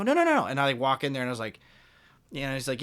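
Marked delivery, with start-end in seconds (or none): 0.0-0.4 quick high-pitched no's, much higher; eager no no no. Retold or imitated. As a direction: insist eagerly, voice jumping up. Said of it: "And I go".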